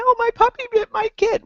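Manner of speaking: high, fast, alarmed parent voice. Retold or imitated. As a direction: blurt it like a panicked parent. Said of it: "your inclination is to say".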